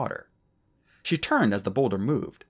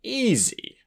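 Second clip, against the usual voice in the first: high, airy know-it-all; lofty; 12 semitones higher than usual. Acted. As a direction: toss it off from above. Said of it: "Starflight had said loftily".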